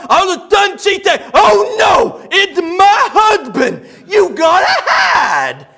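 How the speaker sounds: high mock-panicked shout; worked-up man bellowing the woman's alarm, drawn out; [name]. [name] relays the woman's warning about her husband, shouting. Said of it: "when he gets real worked up".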